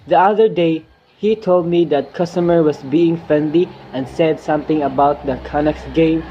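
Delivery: ordinary talk, nothing marked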